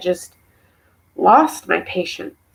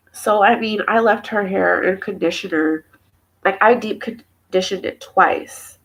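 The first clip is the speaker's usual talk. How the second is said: ordinary talk, nothing marked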